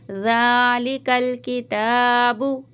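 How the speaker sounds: slow ज़ालिकल with a light stretch on ज़ा; tajweed recitation. Shown as a demonstration. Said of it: "ज़ा को हल्का सा खींचेंगे, लिकल को जल्दी पढ़ेंगे". (ज़ा को हल्का सा खींचकर)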